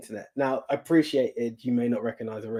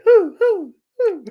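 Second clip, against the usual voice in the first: wrestling whoo; 10 dB louder, slower than usual. Retold or imitated. New Day wrestling chant.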